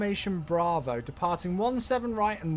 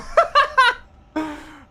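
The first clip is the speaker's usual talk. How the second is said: burst of laughter; 12 dB louder than usual. Real, not acted. Laughs at odd username.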